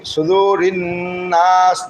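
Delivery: slow সুদুরিন নাস, doubled n hummed; held ghunna. Shown as a demonstration. Teacher shows the compulsory ghunna, humming into নাস. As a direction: hold the nasal n between সুদুরিন and নাস. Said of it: "এক আলিফ গুণনা করা ওয়াজিব".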